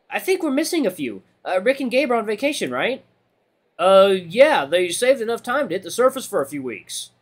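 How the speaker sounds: easy catch-up over cafeteria noise; chatty lunch buddies. Acted. Friends discussing who is away on vacation, chatty and high.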